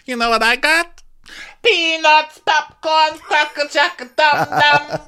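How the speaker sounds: high, loud vendor calling out; stadium vendor call. Pretend. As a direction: hawk snacks up high.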